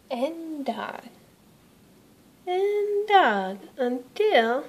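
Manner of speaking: slow, rising build on dug; drawn out. A narrator's reading. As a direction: stretch it out as the digging goes on.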